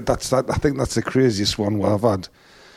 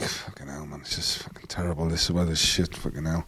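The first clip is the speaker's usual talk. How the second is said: much softer fed-up grumble under the breath; gloomy muttered moan; 5 semitones lower, 10 dB quieter than usual. Retold or imitated. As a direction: moan about the weather, half to yourself. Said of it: "I just felt a bit down".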